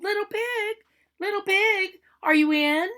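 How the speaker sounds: high sing-song call to the door. Acted. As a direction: call out sweetly to the pig inside. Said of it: "so I called".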